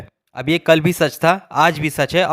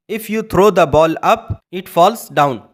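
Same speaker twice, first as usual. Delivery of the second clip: very slow, careful right sentence; teacher's correct example, spoken slowly; correct version; much slower than usual. Shown as a demonstration. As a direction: model the correct English slowly. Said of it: "अब यहाँ पर हम ये नहीं बोलेंगे कि".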